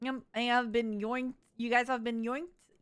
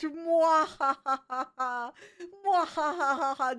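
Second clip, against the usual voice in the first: evil laugh; much slower than usual. Pretend. Declares best evil plan.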